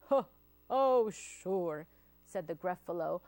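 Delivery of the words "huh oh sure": mildly amused monster scoff; scoffing amusement. Acted. Gruffalo doubts the mouse's boast, scoffing. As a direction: scoff as if holding back laughter. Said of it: "bursting with laughter".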